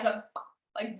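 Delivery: ordinary talk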